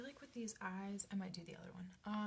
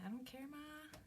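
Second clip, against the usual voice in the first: voicing the cat; 6 semitones higher than usual.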